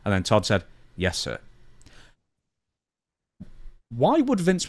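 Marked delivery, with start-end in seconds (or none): none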